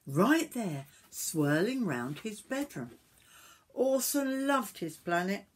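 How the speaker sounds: lively, sing-song storybook reading; story narration to children. A narrator's reading. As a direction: read it aloud to young children.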